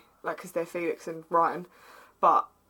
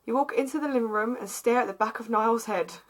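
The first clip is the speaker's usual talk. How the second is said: usual speaking voice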